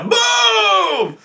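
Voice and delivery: high, drawn-out mock explosion; slow boom. GM recalling the blast, slow mock boom. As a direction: stretch the blast out.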